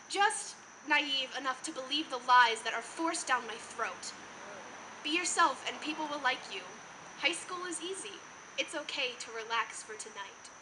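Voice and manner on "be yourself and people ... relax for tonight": hushed, gentle and soothing; softly, as if comforting someone, with pauses to let it land; soft reassurance. Acted. Student voicing the lies adults tell teenagers, soft reassurance.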